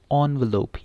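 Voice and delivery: एनवेलप starting with an on sound, on-velop; correct pronunciation. Shown as a demonstration. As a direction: say एनवेलप beginning with on. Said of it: "इसको क्या पढ़ना है". (एनवेलप सही उच्चारण, ऑन से शुरू)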